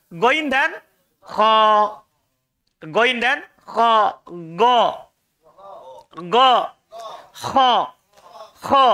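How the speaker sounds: rasping "Kho" and voiced "Go" near the tongue root; upper-throat letter drill. Shown as a demonstration. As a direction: scrape "Kho" from the top of the throat. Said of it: "paling atas apa?".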